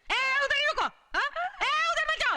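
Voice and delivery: high, loud yell to stop him; shouting at intruder. Acted. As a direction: bark the warning from across the room. Woman shouts at intruding boy. (घुसपैठिए लड़के पर ऊँची आवाज़ में चिल्लाना)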